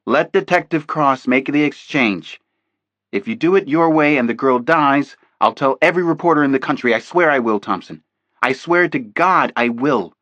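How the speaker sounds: loud, insistent threat; heated vow. A narrator's reading. Jesse Flanagan threatens agent Thompson, heated loud vow. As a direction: swear it at him, slowing at the end.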